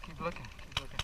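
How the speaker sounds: low, hushed instruction. Real, not acted. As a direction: say it softly to a teammate. Whispered instruction under cover.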